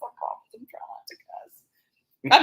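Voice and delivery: hushed whisper, slowed down. Real, not acted. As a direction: whisper it like a confession. Holding back swearing on live.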